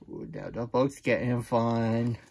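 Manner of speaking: plain reading voice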